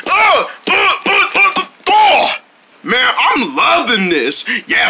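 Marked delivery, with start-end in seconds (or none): 0.1-2.3 high, rapid effort grunts; fighting grunts. Acted. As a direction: grunt out a flurry of blows. Repeated blows in fight.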